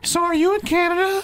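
cruise lady. Pretend. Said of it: "you're gonna pretend to be her".

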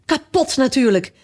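high-pitched vexed cry; agitated outburst. A narrator's reading. Fiedeldij finds his rattle broken, agitated outburst. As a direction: lament it in a temper. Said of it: "wild begon te stampen".

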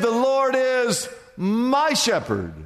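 slow, "my" stressed and falling. Shown as a demonstration. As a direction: dwell on "my" in "The Lord is my shepherd". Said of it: "No, no, no".

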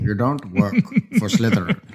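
low, mock Snape with a laugh; a hint of Snape. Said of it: "Snape impersonating".

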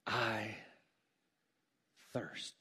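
low, hushed, with a long pause between words; understated cry. Acted. Preacher voicing Jesus on the cross, understated cry. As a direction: let each word drop away softly, slowly parted. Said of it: "where Jesus cries out".

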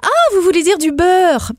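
exaggerated French server seeking confirmation; imitated French waiter. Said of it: "puis que le serveur fait".

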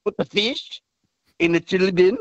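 put-on amateur Kiwi accent in careful chunks; attempted Kiwi accent. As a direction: have a shaky go at Kiwi, drifting towards Indian. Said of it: "in your best Kiwi accent".

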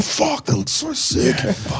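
high, hushed awe; awed. Retold or imitated. Retelling seeing flares, hushed high awe. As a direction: breathe it out in high amazement. Awed reaction in retold story.

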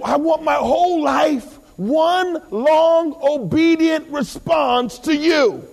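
high, stretched preaching cry; impassioned preaching. Real, not acted. Preacher declares obedience to God, high and impassioned. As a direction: draw the words out high and forceful.

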